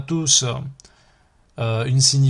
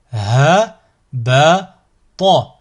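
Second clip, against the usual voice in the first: slow, each syllable drawn out separately; split into syllables; 6 dB louder, much slower than usual. Shown as a demonstration. Teacher models a word for learners to repeat, split into syllables. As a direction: cut the word up, pausing between syllables. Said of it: "décomposer le mot".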